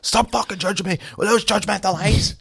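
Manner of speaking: high-pitched mock teacher outburst; ranting teacher. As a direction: imitate a teacher losing it at a pupil. Voicing the religion teacher losing it.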